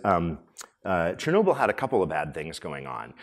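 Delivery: usual speaking voice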